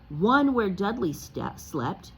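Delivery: ordinary delivery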